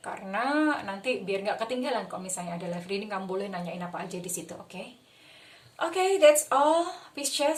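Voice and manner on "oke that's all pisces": high, loud, slow cheery farewell; excited sign-off. Real, not acted. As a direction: sing out the goodbye.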